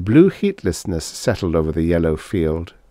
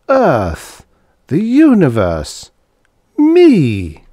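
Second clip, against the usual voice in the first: rising, louder, higher cries of realisation; stunned outcry; 9 semitones higher, 7 dB louder, much slower than usual. Acted. Harvey crying out as memory floods back, stunned. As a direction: let the memory burst out of him. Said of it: "sudden frightful flood of memory".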